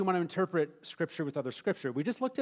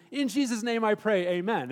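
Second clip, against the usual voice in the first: mock tacked-on prayer; 6 semitones higher, 6 dB louder than usual. Let's just tack on.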